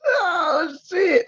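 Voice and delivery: high, thin laughing squeal; helpless high-pitched "oh shit" through laughter; cracking up. Real, not acted. Host losing it at the chat's jokes, cracking up. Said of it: "that's why I'm cracking up".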